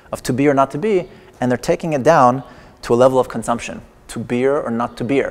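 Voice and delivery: ordinary talk, nothing marked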